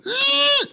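a shrill high-pitched squeal; the little eek he makes when the monster grabs him; high eek noise. Retold or imitated. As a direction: squeak out a tiny horror-movie shriek. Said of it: "I make these noises".

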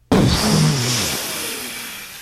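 long noisy puffed-out exhale; big sputtering breath out; Mister Giamavo. Acted. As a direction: blow out cigarette smoke through puffed cheeks. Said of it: "puff his cheeks out and go".

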